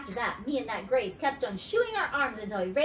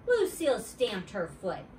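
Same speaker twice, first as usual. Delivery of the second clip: usual speaking voice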